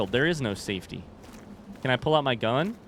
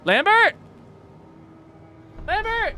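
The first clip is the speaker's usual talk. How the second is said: high shouted call across the dark; 6 dB louder, slower than usual. Real, not acted. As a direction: yell the name to find him. Calling out for lost partner.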